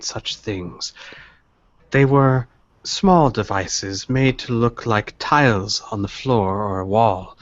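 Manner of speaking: put-on measured old dealer, pausing to recollect; knowing old dealer. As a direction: think aloud slowly, then explain discreetly.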